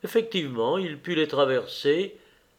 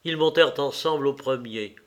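usual speaking voice

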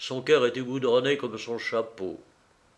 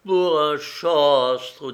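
slow, drawn-out wail of regret; understated woe; tearful lament; 3 semitones higher, 5 dB louder, much slower than usual. A narrator's reading. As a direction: draw it out mournfully. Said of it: "les larmes aux yeux".

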